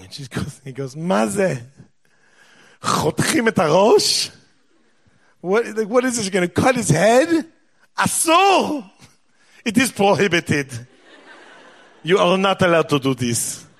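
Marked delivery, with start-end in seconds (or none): 1.0-8.9 high, outraged Israeli outburst; indignant Israeli son. Retold or imitated. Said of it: "he's Israeli". 9.6-13.7 mock Israeli accent. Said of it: "He doesn't talk like that".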